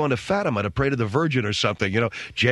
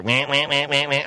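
repeated wah cries; mock animal wailing; imitated animal wah. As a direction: copy the creature's call. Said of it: "I hear".

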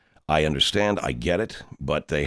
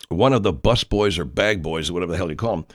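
usual speaking voice

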